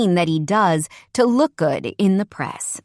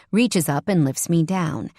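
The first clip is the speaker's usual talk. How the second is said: plain reading voice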